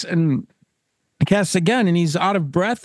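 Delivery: ordinary delivery, nothing marked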